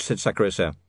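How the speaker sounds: ordinary delivery, nothing marked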